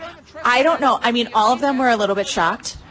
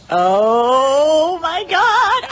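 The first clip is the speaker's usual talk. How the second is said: stunned joy; 6 semitones higher, 4 dB louder, much slower than usual. Retold or imitated. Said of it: "I'm just like".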